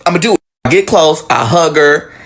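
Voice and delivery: ordinary talk, nothing marked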